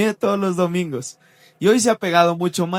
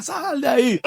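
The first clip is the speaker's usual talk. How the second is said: a higher mock command from the angry father; orden del padre; 9 semitones higher than usual. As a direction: demand he come out of the bathroom. Padre grita ante el baño. (imitando al padre, orden aguda)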